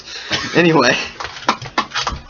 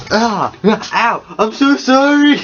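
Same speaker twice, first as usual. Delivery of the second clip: startled yelp; 12 semitones higher, 4 dB louder, much slower than usual. Real, not acted. Hurts himself mid-talk.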